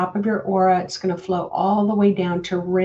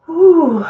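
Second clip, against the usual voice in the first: relieved exhale; 9 semitones higher, 10 dB louder than usual. Real, not acted. Exhaling after the meditation.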